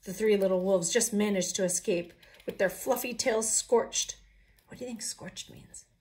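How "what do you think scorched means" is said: hushed suspense whisper; storyteller whispering to the children; whispered question. A narrator's reading. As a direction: lean in and whisper.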